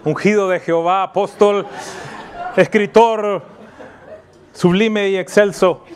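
hammed-up solemn listing, laughing; mock grand titles. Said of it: "Yo no esperaría que Mariana salga y dijera". (títulos pomposos en burla, riendo)

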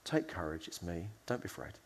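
low, soft, compassionate calming; loving reassurance. Acted. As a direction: reassure someone frightened, very gently. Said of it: "speaks with such amazing love".

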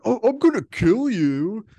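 high, loud whiny threat; exaggerated pretend rage; mock pissed-off killer. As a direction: imitate an angry killer, hammed up. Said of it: "he's getting pissed".

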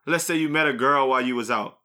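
usual speaking voice

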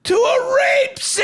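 high, slow, loud anguished shout; horrified yell. Retold or imitated. Panicked brother confessing to his brother, yelling. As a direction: wail the confession. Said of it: "And he goes".

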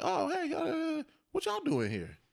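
high, bright and casual, just curious; friendly greeting. Retold or imitated. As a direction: greet them like old friends. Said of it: "It was more like".

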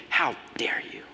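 hissed, indignant whisper; angry whisper. Retold or imitated. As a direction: whisper it with offended fury. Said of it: "in anger, the people are saying".

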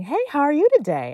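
high, bright hello through a toothy grin; re-enacted cheery greeting; smiling greeting. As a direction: greet the host with big energy. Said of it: "And I smiled with teeth".

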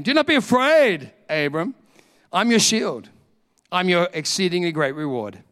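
preacher voicing God to Abram, proclaimed; proclaiming God's words. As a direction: declare it over the congregation.

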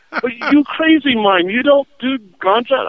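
mock the hustler's disbelief; incredulous Jamaican.

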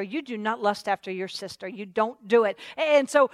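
usual speaking voice

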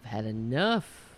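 mock stern.

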